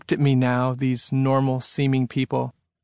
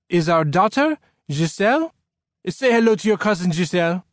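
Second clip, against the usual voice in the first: put-on higher French-accented father; 5 semitones higher than usual. A narrator's reading. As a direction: present your daughter in a French accent. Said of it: "in a French-sounding accent".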